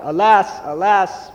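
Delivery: a mild cry of grief; crying out in dismay. Acted. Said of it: "he cried out".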